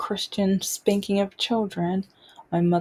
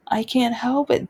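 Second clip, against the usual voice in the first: higher-pitched retold plea; pleading child; 4 semitones higher than usual. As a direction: lightly voice a helpless child's protest.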